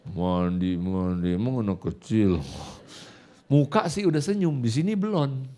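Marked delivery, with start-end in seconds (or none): 0.1-2.4 low, monotone inner grumble; grumbling. Retold or imitated. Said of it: "Tapi di sini masih gerundel".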